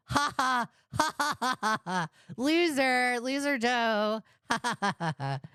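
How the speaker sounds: high fake ha-has sliding down; mocking laughter. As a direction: jeer playfully with forced laughs. Mock laughing at Joe the loser.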